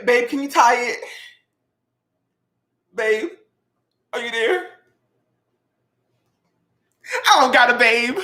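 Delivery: high mock-whiny plea; hammed-up lonely whining; mock whine. Real, not acted. Cook alone in the kitchen whining to no one, mock upset. Begging babe then lamenting alone.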